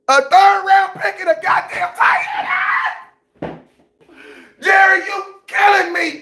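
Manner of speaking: high-pitched tearful wail; mock crying. Fan wails at team owner over trade, mock crying. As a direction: wail the complaint through hammed-up tears. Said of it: "am not crying".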